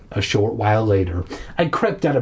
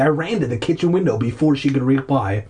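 plain reading voice

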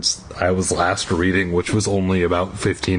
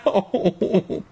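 1 high yelp of pain; 9 semitones higher than usual. Acted. Knee slammed in story.

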